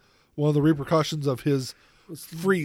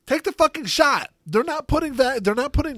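higher, louder exasperated outburst; 10 semitones higher, 4 dB louder than usual. Real, not acted. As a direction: snap it out in frustration.

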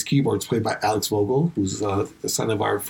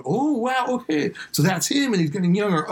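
realizing; 8 semitones higher than usual. Retold or imitated. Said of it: "get close to going".

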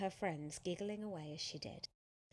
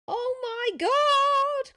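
very high, drawn-out fan squeal; excited cry; 20 dB louder, much slower than usual. A narrator's reading. Girl fan after the star rides off, crying out. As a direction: shriek it loud and long. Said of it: "cried a girl".

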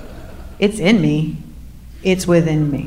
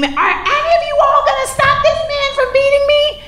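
high-pitched scream. Retold or imitated. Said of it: "I scream".

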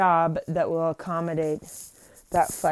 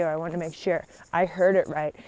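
ordinary talk

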